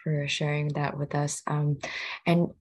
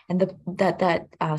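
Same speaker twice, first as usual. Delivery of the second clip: ordinary talk, nothing marked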